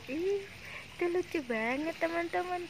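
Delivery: high, bright delight at the birds; delighted squeal. Real, not acted. Vlogger delighting at sparrows in the tree, high excited. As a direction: coo over the cute sparrows.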